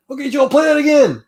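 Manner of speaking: high, loud eager command; excited band director. Retold or imitated. Director to Joel with phone out, high loud eager shout. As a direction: call it out, thrilled.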